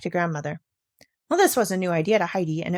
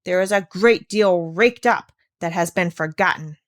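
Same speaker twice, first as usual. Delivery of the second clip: ordinary delivery, nothing marked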